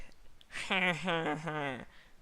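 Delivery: high, drawn-out laugh falling away; hammed-up braying chuckle; donkey laugh. A narrator's reading. Donkit laughing at the baby news, exaggerated.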